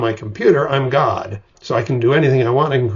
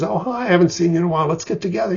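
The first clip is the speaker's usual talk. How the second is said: chatty friend; 7 semitones higher, much faster than usual. Acted. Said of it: "passes by and says".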